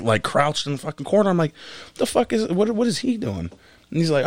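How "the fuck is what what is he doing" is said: high, baffled disbelief; incredulous. Retold or imitated. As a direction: ask it in shocked confusion. Retold disbelief at the porch.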